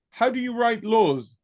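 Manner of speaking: normal talking voice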